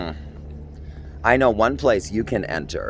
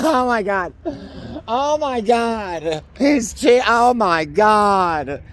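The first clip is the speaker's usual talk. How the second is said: laughing disbelief; 11 semitones higher, slower than usual. Real, not acted.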